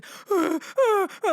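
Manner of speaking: high, heaving sob breaths; mock sobbing. Showing how she walked to the VIP section, mock sobs. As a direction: imitate walking along sobbing. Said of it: "like, sobbing, crying".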